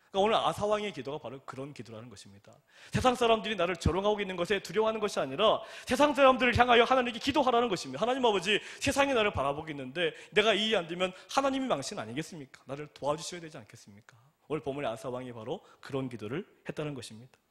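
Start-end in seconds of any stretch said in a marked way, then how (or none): 2.9-14.2 louder, higher sermon climax; fervent preaching. Acted. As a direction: preach it out to the congregation. Preaching at full force.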